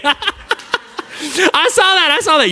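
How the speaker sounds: laughing as he calls her out. Real, not acted. Catches kid rolling eyes.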